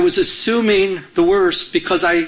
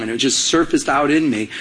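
ordinary talk, nothing marked

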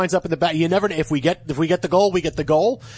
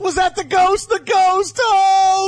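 excited celebration; 13 semitones higher, 4 dB louder than usual. Real, not acted. Host cheering his Hurricanes' go-ahead goal, excited shouting. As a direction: whoop it up over a goal.

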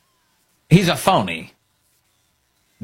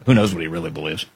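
ordinary talk

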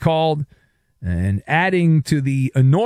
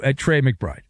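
usual speaking voice

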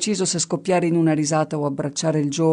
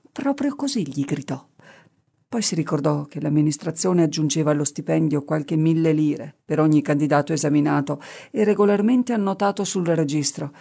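ordinary delivery, nothing marked

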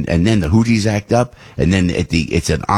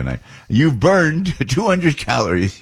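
slow, higher robotic announcer imitation; mock fitness app voice; 7 semitones higher, slower than usual. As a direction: play the smug Apple watch reading out your stats. Apple watch voice announcing workout.

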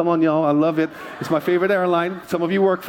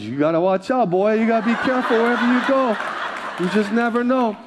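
lightly voiced mom, rising in pitch; mock motherly caution; warning mom; 4 semitones higher than usual. Mom warning her son to be careful, lightly imitated higher voice. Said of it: "She goes".

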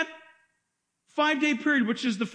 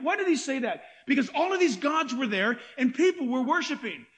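usual speaking voice

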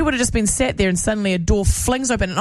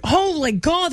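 higher startled exclaim; higher alarmed gasp; mock shock; 8 semitones higher, slower than usual. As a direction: retell the panic. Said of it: "and I was like".

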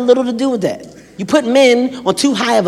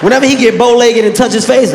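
ordinary talk, nothing marked

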